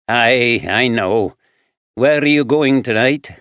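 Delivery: lightly voiced rough Glaswegian man; gruff Glaswegian drifter answering; Glaswegian accent. Fraser voiced.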